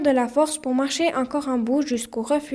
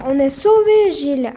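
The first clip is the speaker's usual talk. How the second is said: elated announcement; 8 dB louder than usual. A narrator's reading. Camille hears the helicopter and tells Gilles, elated. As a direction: shout the good news to a friend.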